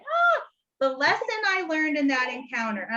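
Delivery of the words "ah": squealed ah far higher; high joyful exclaim; high delighted ah. Retold or imitated. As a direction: let out a high happy squeal. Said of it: "I was like".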